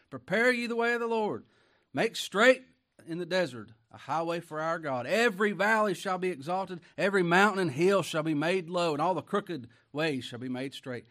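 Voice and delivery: loud, high proclaiming of scripture; preaching. Acted. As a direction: cry it out like a prophet. Preacher proclaims Isaiah passage.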